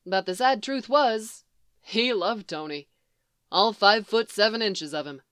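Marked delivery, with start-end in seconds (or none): none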